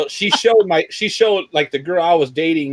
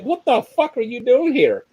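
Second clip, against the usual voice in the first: shocked outburst; 9 semitones higher than usual. Retold or imitated. Finds best friend in jail.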